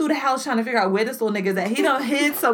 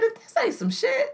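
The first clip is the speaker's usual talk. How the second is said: much higher mock exasperated voice; 12 semitones higher than usual. Dad retelling his own fed-up remark, high mock voice. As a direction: squeak it in disbelief. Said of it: "I said".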